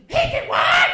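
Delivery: high, loud shrieking wife; wife shrieking from the house; shocked wife. Retold or imitated. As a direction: shriek it like a horrified mother. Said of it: "I hear her".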